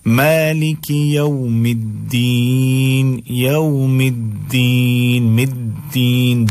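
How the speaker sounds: "الدین" very slow, leaning on the double d; doubled daal recitation. Shown as a demonstration. Teacher modelling for learners, slow recitation with doubled d. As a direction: stretch "یوم الدین" with long held vowels. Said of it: "دو دال ہیں".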